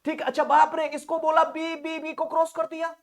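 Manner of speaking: a big theatrical gasp of wonder at the word बाप रे; loud mock amazement. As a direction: exclaim it high and loud, hamming up the shock. Teacher's mock amazement, shouted. (ऊँची, ज़ोरदार नाटकीय हैरानी)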